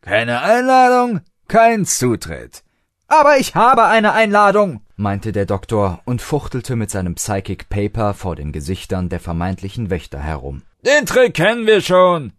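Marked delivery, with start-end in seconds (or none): 0.0-2.6 bouncer refusing. A narrator's reading. 3.1-4.8 eager protest. A narrator's reading. Doctor protests to bouncers. 10.8-12.3 dismissive bouncer. A narrator's reading. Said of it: "winkte der Zweite ab".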